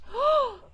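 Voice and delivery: big, loud amazed gasp; delighted gasp. Acted. Streamer hears of a viewer's Minecraft build, delighted gasp.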